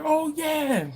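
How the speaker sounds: high, bright-eyed oh yeah; kids' eager yes. Retold or imitated. Kids recalling cruise escargot, eager and high. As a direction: kids remembering the treat. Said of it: "They're like".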